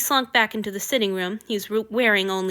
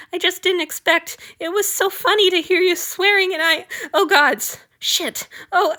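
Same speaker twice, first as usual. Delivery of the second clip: high, loud frantic babble; panicked babbling; 10 semitones higher, 3 dB louder than usual. A narrator's reading. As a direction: gush out apologies in a panic. Servant babbling after laughing fit.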